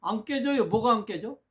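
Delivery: emphatic exclamation. Real, not acted. Preacher exclaims to audience.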